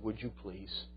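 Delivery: small, hushed acted request; quiet imploring question; soft plea. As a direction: plead very softly, imitating a timid girl. Voicing girl's timid plea.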